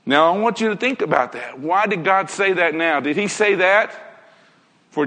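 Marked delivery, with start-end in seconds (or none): none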